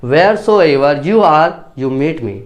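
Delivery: very slow, each word of Wheresoever drawn out and pressed; forceful emphasis. Shown as a demonstration. Teacher showing students emphatic English, slow and forceful. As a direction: lean hard on Wheresoever and meet, deliberately slow. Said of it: "अब English में कैसे बोलोगे जोर दे के".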